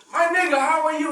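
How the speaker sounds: high, loud, beaming imitation of an African man's greeting; cheerful imitation of a local man, high and loud; mock African greeting.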